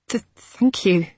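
stammering through chattering teeth; shivering with cold, teeth chattering. Acted. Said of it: "her teeth chattered".

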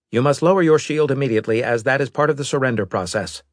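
fast, cold robot voice; a hint of a machine envoy; lightly voiced robot.

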